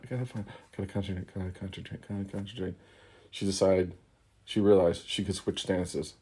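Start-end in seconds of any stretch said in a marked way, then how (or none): 0.0-2.7 panicked muttering. Acted. Letty panics, tries to focus.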